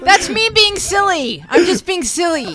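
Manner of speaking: high, drawn-out playful protest; high-pitched protest. Real, not acted. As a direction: squeal it in self-defence, pitch way up. Protests that the deadpan was joking.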